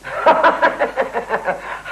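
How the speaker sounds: drawn-out ha-ha-ha laugh; long chuckling laugh. Acted. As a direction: laugh in a long run of pulses. Mocking laugh before promise. (लंबी ठहाकेदार हँसी)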